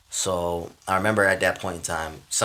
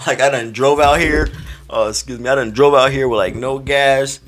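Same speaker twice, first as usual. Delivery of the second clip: loud, raised-pitch comic grievance; mock indignation; 5 semitones higher, 7 dB louder than usual. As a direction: complain loudly as if wronged. Continues mock protest to crowd.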